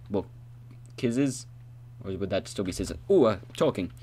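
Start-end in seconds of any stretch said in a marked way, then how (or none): none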